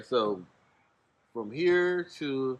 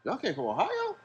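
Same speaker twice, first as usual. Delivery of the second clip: higher, amazed question; surprised host; 10 semitones higher, 4 dB quieter than usual. Retold or imitated. Host amazed they came from Ohio, higher pitched. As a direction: act out his disbelief. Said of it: "He's like".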